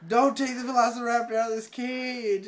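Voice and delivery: high, loud imitated hunter's order; acted urgent yell; shouted warning. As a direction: bellow it as a dramatic command. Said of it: "he's like".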